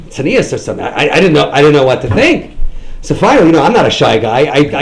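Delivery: ordinary talk, nothing marked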